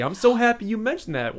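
high-pitched fake enthusiasm; hammed-up grateful surprise; mock delight. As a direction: gush as if it weren't planned. Mock delight at planted question.

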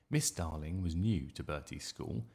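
ordinary delivery, nothing marked

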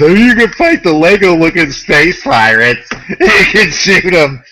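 high, loud mock enthusiasm; hyped-up mockery of a game advert; mocking sales pitch. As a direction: sneer the pitch with a laugh breaking in.

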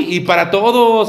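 ordinary delivery

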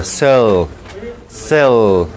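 "cell" repeated low, E half closed. Shown as a demonstration. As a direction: model "cell" carefully, holding the half-closed E. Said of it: "con una, una E medio cerrada".

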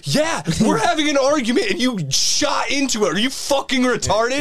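high-pitched mock yell, little added volume; retold screaming. Mom and child telling off a family, mock screaming. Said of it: "screaming at them".

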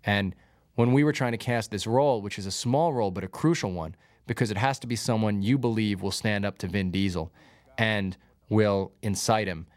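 normal talking voice

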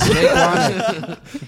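high, slow, laughing drawl. Pretend. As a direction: stretch the name out while laughing. Mocking the name with a laugh.

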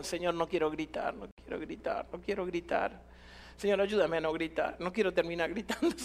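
low, hushed private plea; quiet pleading prayer. Retold or imitated. Preacher begs the Lord before preaching not to shout, mock-meek prayer. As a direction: murmur it soft and low, half amused at the end. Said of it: "esta es mi oración".

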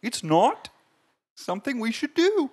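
slow high puppet voice, creeping upward; Jordan Peterson. Acted. Co-host mocking views on women, rising high impression. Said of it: "Just progressively gets higher".

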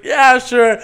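high, loud, imitated agreement; mock eager yes. Rescuer agreeing to an injured man's kiss, imitated eagerly.

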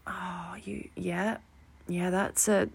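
breathy embarrassed whisper, barely there; awkward whisper. Retold or imitated. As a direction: whisper it like you wish you could vanish. Awkward reply, no money to pay.

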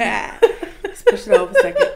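slow, high laughing line. Real, not acted. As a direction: laugh the words out. Laughing while talking.

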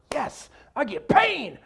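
high, drawn-out fake delight; hammed-up eagerness; mock glee. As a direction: exaggerate a thrilled cheer, slowly. Mocking glee at being persecuted.

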